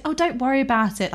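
a hint of a reassuring mum. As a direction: play mum brushing off worry. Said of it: "She's like".

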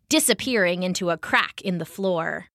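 ordinary delivery

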